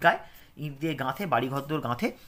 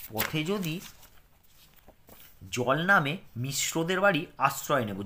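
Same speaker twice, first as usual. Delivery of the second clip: clear, careful lesson reading for pupils; reading aloud; 4 semitones higher, 5 dB louder, slower than usual. Acted. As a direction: read it out slowly for children to follow. Reads the dialogue text.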